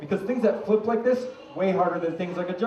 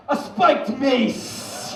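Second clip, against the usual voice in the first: dramatic reveal; 7 semitones higher, much slower than usual. Acted. Slow dramatic prop reveal.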